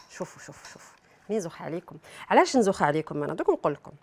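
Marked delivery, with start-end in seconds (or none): 0.1-1.0 whisper. Acted. Playful aside to viewers.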